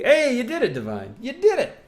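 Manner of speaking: high excited congratulation; cheering. Acted. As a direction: cheer them on brightly. Cheering a viewer.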